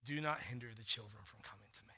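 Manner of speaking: low, soft, measured counsel; calm wise friend. Retold or imitated. Pastor friend calmly counseling a worried dad, low and soft. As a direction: answer gently like a calm mentor. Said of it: "He's like".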